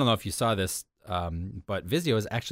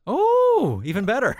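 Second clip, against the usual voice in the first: mock delight; 14 semitones higher, 7 dB louder, slower than usual.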